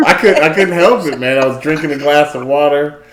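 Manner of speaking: words broken up by loud, high laughter; can barely get it out for laughing; laughing so hard the story spills out. Real, not acted. Cracking up retelling the water spill.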